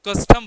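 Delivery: Custom loud on कस, fading on टम; stress on first syllable. Shown as a demonstration. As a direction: let टम fade after a strong कस in custom. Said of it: "बहुत उसकी sound कम होती जाती है".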